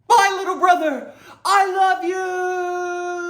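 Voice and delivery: high, loud, slow drawn-out shout; big brother bellowing goodbye with a laugh; fond yelling. Pretend. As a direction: call after him embarrassingly loud.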